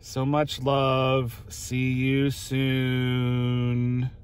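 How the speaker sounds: slow, lingering sign-off; slow drawn-out. Acted. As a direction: stretch every word very slowly. Drawn-out sign-off reading letter.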